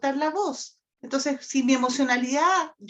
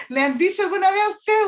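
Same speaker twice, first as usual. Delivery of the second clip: higher and louder, laughing through it; amused, raised question; 5 semitones higher, 6 dB louder, faster than usual. Real, not acted. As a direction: ask the group with a laugh. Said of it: "laughing question to audience".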